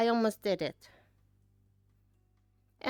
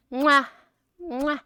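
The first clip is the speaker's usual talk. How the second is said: blown kisses; 4 dB louder than usual. Real, not acted. Blowing kisses.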